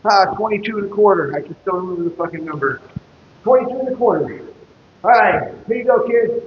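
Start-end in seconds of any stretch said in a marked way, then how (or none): 0.0-1.3 raised call across the site; calling out a number. Retold or imitated. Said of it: "I call one out". 3.4-6.4 coworker calling back. Retold or imitated. Coworker repeats, tosses stake.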